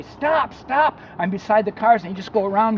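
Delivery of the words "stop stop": calling out stop. Retold or imitated. Calling to cars in dream.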